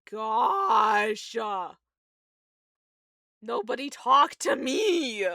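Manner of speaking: loud, drawn-out mock wail; dramatic outcry. Fan overwhelmed by tragic last words, dramatic wail. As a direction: cry out as if too upset for company.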